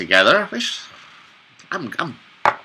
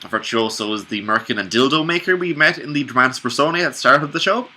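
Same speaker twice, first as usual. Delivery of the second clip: ordinary talk, nothing marked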